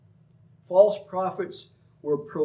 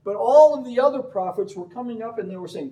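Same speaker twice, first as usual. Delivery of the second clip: ordinary talk, nothing marked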